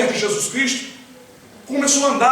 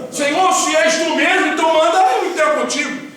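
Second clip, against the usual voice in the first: calling out; 7 semitones higher, 4 dB louder than usual. Acted. Peter calling to Jesus on water.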